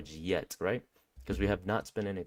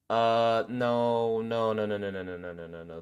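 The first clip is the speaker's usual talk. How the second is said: dismayed refusal. Retold or imitated. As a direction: start firm, then slide down and die away. Acted refusal of the spot.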